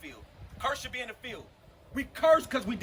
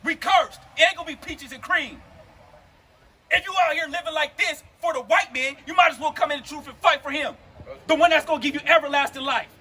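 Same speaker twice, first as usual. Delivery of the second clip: forceful, raised preaching voice; impassioned street preaching; 4 semitones higher, 6 dB louder than usual. Real, not acted. Preacher challenging a brother about suffering, impassioned preaching. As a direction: drive each phrase home with weight.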